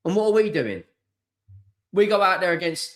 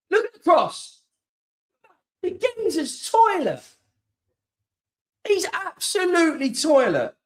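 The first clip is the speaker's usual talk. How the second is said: higher, disgusted rant at a bad cross; angry outburst; 10 semitones higher than usual. Real, not acted. Fan slamming a bad cross to viewers, disgusted and angry. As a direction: spit out your disgust at the player.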